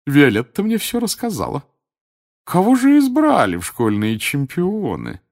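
high-pitched, eager gossipy lady; society lady fishing for news; inquisitive lady. Acted. Curious portrait lady wants the news.

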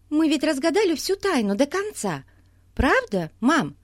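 put-on high, eager child voice; bubbly young girl, proud; excited little girl. A narrator's reading. Голос Бетси в сказке. (восторженная девочка)

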